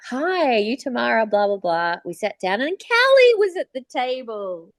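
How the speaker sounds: ordinary delivery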